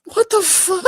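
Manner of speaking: high, stunned outburst; disbelieving what the fuck; shocked. Real, not acted. Shocked at absurd claim.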